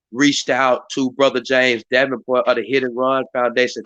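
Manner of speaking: normal talking voice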